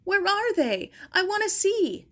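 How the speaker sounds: high, loud and eager; urgent excitement. Acted. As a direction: demand it breathlessly.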